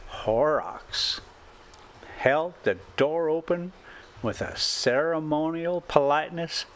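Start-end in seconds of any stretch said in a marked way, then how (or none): none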